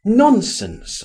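high, dismissive exclamation; crying out. A narrator's reading. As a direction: brush it aside with a cry. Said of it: "I cried".

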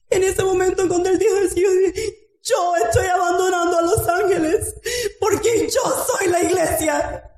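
high, strained exhortation; impassioned preaching. Real, not acted. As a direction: preach with full fervour, drawing out the key words. Preaches at full force.

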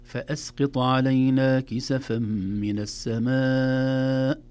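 slow, loud recitation, long held السماء; مد متصل. Shown as a demonstration. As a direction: chant it, sustaining the "maa" of السماء. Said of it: "مد وجه متصل". (تلاوة مرتلة مع مد السماء)

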